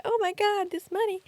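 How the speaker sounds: high-pitched panicked gasp, imitating herself; replaying her own money panic; mock freak-out. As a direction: squeak it out in retold panic. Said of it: "my little freak out avoidant moment".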